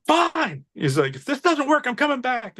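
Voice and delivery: high-pitched mock huffy concession; grudging surrender. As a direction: imitate a customer giving in under protest. Customer grudgingly gives in, acted.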